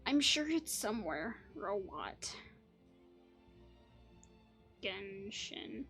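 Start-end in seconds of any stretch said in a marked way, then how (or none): none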